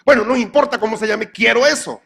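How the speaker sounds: just give me that, fed up; exasperated demand. Retold or imitated.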